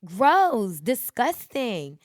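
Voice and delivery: high, loud exclamation of disgust; grossed-out reaction. Retold or imitated. Reacting to someone's uncovered sneeze, loudly grossed out. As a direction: recoil loudly in exaggerated disgust. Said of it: "and I'm like".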